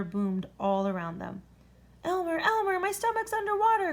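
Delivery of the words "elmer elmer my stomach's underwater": much higher, louder alarmed call; cried out. A narrator's reading. Dragon wakes Elmer because his stomach is underwater, alarmed. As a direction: wake him up in a fright. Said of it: "cried the dragon".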